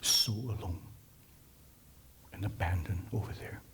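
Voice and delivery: softly whispered, fast. Real, not acted. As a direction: let it fall to a whisper. Dwelling on the woman's loneliness.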